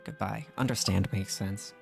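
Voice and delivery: ordinary talk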